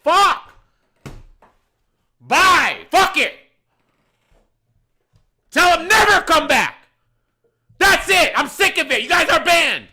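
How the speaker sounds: high, loud outburst in short bursts; angry yelling. Retold or imitated. Manager on FaceTime to a walkout customer, furious retold shouting. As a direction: blow up and throw them out for good.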